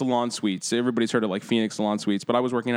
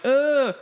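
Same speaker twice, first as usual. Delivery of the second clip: groan; 12 semitones higher, 3 dB louder than usual. Retold or imitated. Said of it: "and I'm like".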